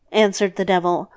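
usual speaking voice

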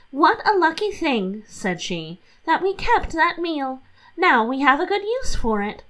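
lightly voiced cheerful housewife; pleased Catherine. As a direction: sound proud of a silly idea.

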